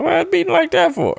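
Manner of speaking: high, alarmed squeak; a sudden panicky high voice; startled. Retold or imitated. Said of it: "I'm like".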